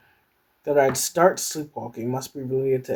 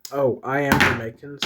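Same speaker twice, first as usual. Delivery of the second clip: usual speaking voice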